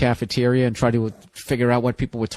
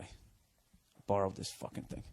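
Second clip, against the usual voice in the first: grumbled aside; 7 semitones lower, 17 dB quieter, slower than usual. Real, not acted. Grumbling aside about borrowed iPad.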